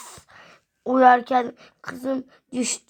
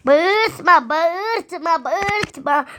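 raised, repeated scolding shout; play-acted yelling; 5 semitones higher, 5 dB louder, slower than usual. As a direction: hammer it out loudly, pitch swooping up and falling. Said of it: "Sabah köründe bağırıyoruz".

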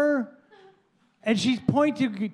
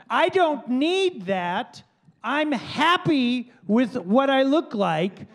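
slow firm refusal; 2 semitones higher, slower than usual. Retold or imitated.

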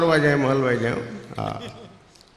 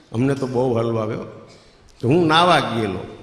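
ordinary talk, nothing marked

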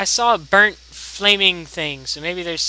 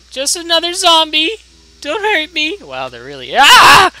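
startled; 12 semitones higher, 12 dB louder than usual. Real, not acted. Zombie attacks, panicked squeals.

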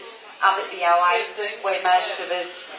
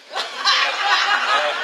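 high, loud cackle; real high laugh; burst of laughter; 13 semitones higher, 5 dB louder than usual. Real, not acted. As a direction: crack up at the punchline.

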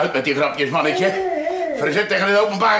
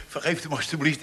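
usual speaking voice

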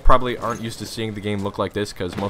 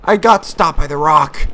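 loud, high, slow complaint; frustrated outcry; 5 semitones higher, 8 dB louder, slower than usual. Real, not acted. Player stopped by a rock, frustrated outcry. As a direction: cry out in frustration, dragging the words.

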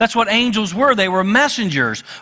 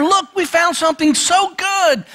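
excited discovery; 11 semitones higher than usual. Retold or imitated.

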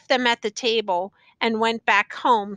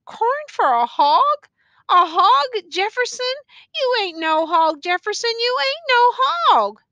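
appalled protest; 11 semitones higher, 5 dB louder than usual. A narrator's reading. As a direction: echo it in pained disbelief, pitched way up. Woman appalled, protesting prisoner's words.

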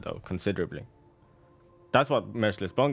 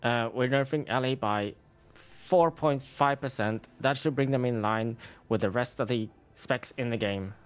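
nerd voice; 4 semitones higher than usual. Acted. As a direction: play a nerdy game dev announcing nerfs. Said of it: "Bro has the nerd voice down".

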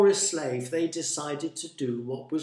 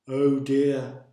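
louder, mild groan of dismay; 6 dB louder than usual. A narrator's reading. Jonah sinking in the sea, groaning. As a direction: groan it like a hard-done-by complainer. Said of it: "Jonah groaned".

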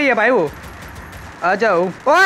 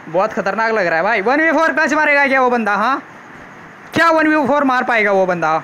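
ordinary talk

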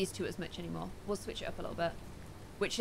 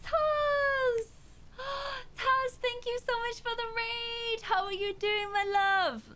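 excited raid greeting; 13 semitones higher, slower than usual. Real, not acted. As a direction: squeal a happy thank-you to a raider. Thanking a streamer for a raid.

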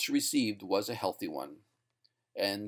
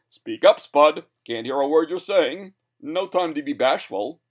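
loud, higher stage prodding; announcer calling out; 4 semitones higher, 8 dB louder than usual. A narrator's reading. Announcer prodding silent Spud on stage, calling out loudly. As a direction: urge the dummy loudly for the crowd.

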